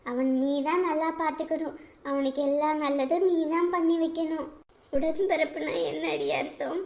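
ordinary talk

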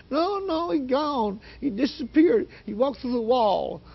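high, panicky imitated voice; scared, shaking daughter. Shaken daughter explaining where the man went, high panicky voice. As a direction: insist in a high frightened voice. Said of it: "she's still shaking like this".

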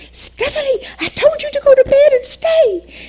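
a mother catching her daughter out of bed; scolding mother. Retold or imitated.

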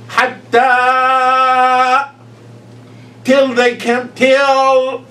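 high, loud, drawn-out "حتى", then "till they came, till"; exaggerated elongation. Shown as a demonstration. Stretched demo of the long vowel.

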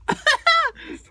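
squealing high laugh. Real, not acted. Laughing at her own joke.

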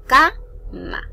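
"cama" with CA punched high and loud; stress on first syllable. Shown as a demonstration. Teacher showing a llana word, "cama" with loud high CA. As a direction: hit CA hard, let "ma" drop in "cama". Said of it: "La mayor fuerza de voz recae en la K".

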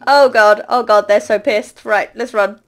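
ordinary delivery

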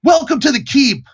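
high, hearty called-out greeting; high gatekeeper holler; yelled welcome. Acted. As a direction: call out through the door to newcomers. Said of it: "he yells out".

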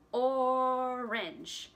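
orange split into two slow beats. Shown as a demonstration. Teacher shows kids two parts of orange, slow beats. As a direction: say orange as or-ange. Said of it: "orange has two parts to it".

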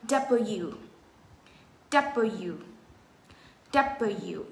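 "W" strong on DUB, then falling and lighter; stress on first syllable. Shown as a demonstration. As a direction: punch the first syllable of "W", let the rest drop. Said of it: "Chữ đất nói mạnh, bow u không được nhấn". ("W" nhấn mạnh âm đầu, xuống giọng)

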